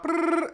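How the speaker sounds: high brrr like a speeding car; zooming noise. Retold or imitated. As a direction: buzz off with a quick brrr. Imitating zooming off.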